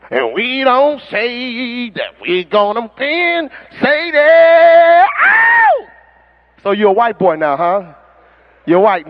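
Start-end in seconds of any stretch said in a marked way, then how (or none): 0.1-5.1 slow drawn-out stage call that bursts into a shout; mock rock singer. Preacher mocks a rock star firing up the crowd, slow rising shout. 5.3-5.9 high rock-star squeal; mock rocker yelp. Preacher imitating a rock singer's high yelp.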